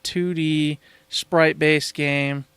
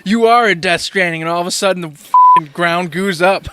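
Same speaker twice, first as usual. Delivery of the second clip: excited; 3 semitones higher, 8 dB louder than usual. Real, not acted. Wild made-up disaster story.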